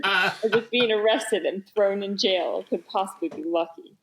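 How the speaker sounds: usual speaking voice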